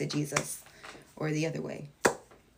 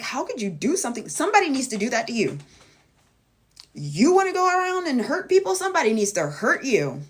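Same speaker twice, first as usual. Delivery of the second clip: higher, louder, indignant rant; retold outrage; 6 semitones higher, 8 dB louder than usual. Reacting to hateful people, high and angry. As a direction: fume at someone who hurts people. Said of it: "I'm like".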